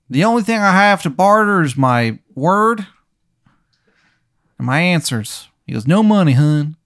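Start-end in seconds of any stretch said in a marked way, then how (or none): 0.1-5.5 bad Maryland accent. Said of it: "this guy's accent sounds like shit". 5.9-6.8 bad Maryland accent. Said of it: "this guy's accent sounds like shit".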